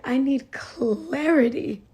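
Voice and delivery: slow, drawn-out plea in imitation; tearful plea, imitated. Crying mother explains why she needs prayer, imitated tearful plea. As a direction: stretch it out as a mock tearful appeal. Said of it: "She started crying".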